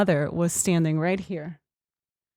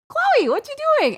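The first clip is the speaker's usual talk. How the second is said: very high, sing-out puzzled call; retold squeal of puzzlement, pitched way up; insistent calling out. Woman re-asking her silent little cousin in the bush, insistent high call.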